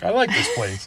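high, lightly delighted aside; delighted quoted reaction. Retold or imitated. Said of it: "which I'm like".

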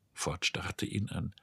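ordinary delivery

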